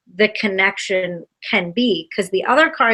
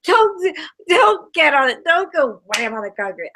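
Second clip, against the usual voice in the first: laughing protest; 5 semitones higher than usual. Real, not acted.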